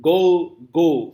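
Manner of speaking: "Go, go" with two equally loud beats; both syllables stressed. Shown as a demonstration. Teacher demonstrating a spondee, both syllables stressed. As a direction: punch each word of "Go, go" equally. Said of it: "Both the syllables are stressed".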